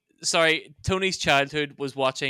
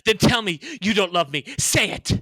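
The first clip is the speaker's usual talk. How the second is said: raised, pleading American melodrama; dramatic demand; 4 semitones higher, 4 dB louder than usual. Acted. Student challenging a lover mid-fight, dramatic. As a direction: press them hard, hammed-up. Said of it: "dramatically act this out for you".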